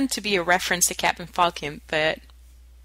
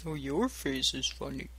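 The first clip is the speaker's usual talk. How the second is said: low, much softer game line; quoted game voice; 6 semitones lower, 13 dB quieter than usual. Retold or imitated. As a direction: drop low and quiet for the line. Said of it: "You're like a little raisin".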